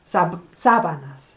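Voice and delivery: "sábanas" with a weighty first syllable; stress on SA. Shown as a demonstration. Teacher drilling the accent, first-syllable stress on "sábanas". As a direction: isolate "sab", then say SA-ba-nas. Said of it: "The accent on the sa".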